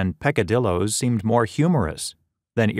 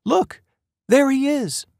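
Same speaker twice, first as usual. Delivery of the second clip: very high, nervous cry; slower than usual. Acted. Mother pointing out a stranger to her son, very high and nervous. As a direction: point him out in alarm. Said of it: "Mom said nervously".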